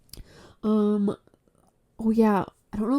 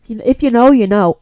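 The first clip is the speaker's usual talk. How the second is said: laughing; 3 semitones higher, 11 dB louder than usual. Real, not acted. Laughing at her own joke.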